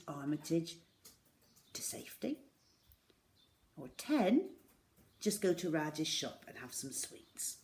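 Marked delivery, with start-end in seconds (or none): none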